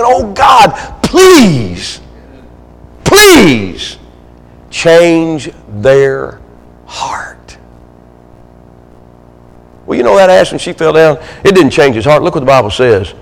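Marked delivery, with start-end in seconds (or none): 0.0-7.3 drawn-out pleas with long pauses, sinking to a hush; begging prayer. Acted. People on their knees begging God, anguished pleading. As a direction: cry out high, then fade away. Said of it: "begging and begging and begging".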